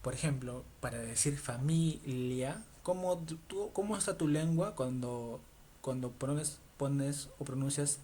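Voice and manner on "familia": familia slowly split as fa-mi, LIA; a clear tongue-tip l in familia; Spanish pronunciation. Shown as a demonstration. Teacher shows where the tongue sits in Spanish, slow pronunciation. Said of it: "lo que pasa es que en español".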